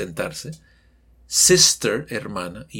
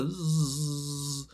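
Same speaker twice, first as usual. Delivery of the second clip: soft, voiced zzz; bumblebee buzz; 6 dB quieter than usual. Shown as a demonstration. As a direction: buzz like a passing bee. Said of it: "como el sonido del abejorro".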